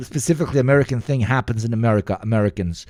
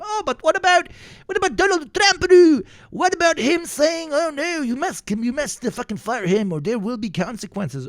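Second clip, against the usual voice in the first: high, whiny mock voice, much higher; mocking Republican voice; 12 semitones higher than usual. Streamer mocking Republicans on Trump and censorship, high whiny mock voice. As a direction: squeak it out like a sneering pundit. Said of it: "they're like".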